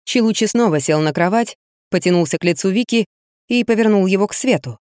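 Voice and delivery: ordinary delivery, nothing marked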